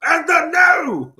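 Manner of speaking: high, loud, slow announcer call; announcer shout. Pretend. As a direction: stretch it like the ring announcer. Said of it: "I'm gonna say that all day".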